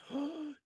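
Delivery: high sharp gasp of delight; excited gasp. Real, not acted. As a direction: gasp in amazement. Gasp at the find.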